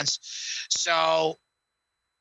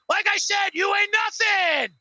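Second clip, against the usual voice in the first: hammed-up Rock catchphrase yell; Rock impression; 10 semitones higher than usual. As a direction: sneer it down at Cena like The Rock. Rock taunting Cena.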